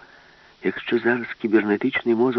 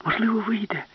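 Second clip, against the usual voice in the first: much higher, eager call; urgent hope; 13 semitones higher than usual. Acted. Alice urges the professor on, high eager call.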